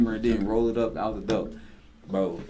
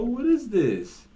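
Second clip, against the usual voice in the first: surprised question; 14 semitones higher, 5 dB louder, slower than usual. Real, not acted.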